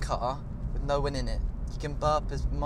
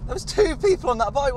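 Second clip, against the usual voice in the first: high, louder burst of disbelief; incredulous exclamation; 9 semitones higher, 3 dB louder than usual. Real, not acted. Driver spotting two people on one bike, incredulous. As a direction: exclaim it in high-pitched amazement.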